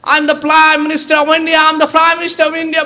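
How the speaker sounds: high, slow, drawn-out yelling of 'I am the Prime Minister of India'; shouting. Retold or imitated. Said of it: "बहुत चिल्ला रहा है वो".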